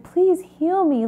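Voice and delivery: high, slow plea; pleading prayer. Retold or imitated. Retelling her prayer to God, high slow pleading. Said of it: "just being like".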